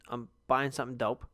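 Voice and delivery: normal talking voice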